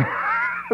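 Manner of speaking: high shouted laugh; a whooping laugh; real laughing burst. Real, not acted. Laughs at writer crying.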